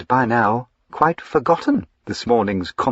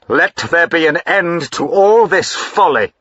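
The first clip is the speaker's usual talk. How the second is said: louder, higher, a sudden furious outburst; angry cry; 7 semitones higher, 6 dB louder than usual. Acted. Husband ordering his wife to stop her folly, angry cry. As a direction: snap at her like a husband out of patience. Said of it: "he cried".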